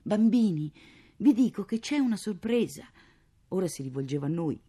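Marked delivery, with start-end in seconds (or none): 0.0-2.9 loud, higher eager call; rally the children for a surprise; announcing. Acted. (annuncio vivace ai bambini)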